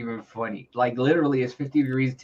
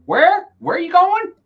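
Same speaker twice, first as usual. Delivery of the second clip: much higher, louder, puzzled question; mock confused locals; 9 dB louder than usual. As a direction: play a baffled local. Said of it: "and they go".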